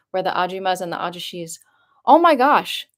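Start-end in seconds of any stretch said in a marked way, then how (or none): 2.1-2.9 high, loud surprised gasp of thanks; real surprise. Real, not acted. To a viewer who just donated, surprised thanks. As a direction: caught off guard by a gift.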